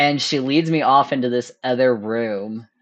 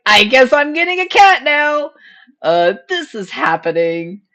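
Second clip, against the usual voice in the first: squeaky disbelief; 12 semitones higher, 5 dB louder than usual. Retold or imitated. Realizing she's getting a cat.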